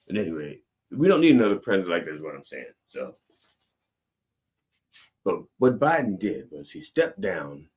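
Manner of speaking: ordinary delivery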